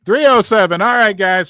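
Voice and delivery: loud, triumphant announcement; announcing the like count to viewers in a loud triumphant shout; excited shout. Real, not acted. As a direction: shout the number out like a victory. Excited about hitting like goal.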